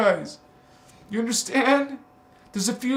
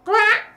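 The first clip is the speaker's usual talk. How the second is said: shrill high bird squawk, loud; parrot squawk; 6 dB louder than usual. Pretend. Squawking like a parrot at the viewers.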